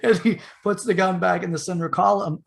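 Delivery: normal talking voice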